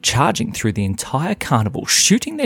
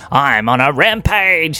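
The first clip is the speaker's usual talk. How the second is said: slow, loud mischievous boast; rampaging little boy; 3 semitones higher, 5 dB louder, slower than usual. Acted. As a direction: announce it with drawn-out relish. Samuel on a spree.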